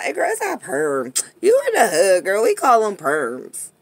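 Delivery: put-on loud, sassy neighborhood girl. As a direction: set her straight with attitude. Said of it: "They be like".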